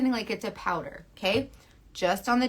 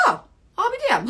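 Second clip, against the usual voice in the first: high, quick, louder amazed reaction; mock surprise; 12 semitones higher, 5 dB louder, faster than usual. Imagined viewer seeing the coverage, mock surprised. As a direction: act pleasantly astonished, pitched up high.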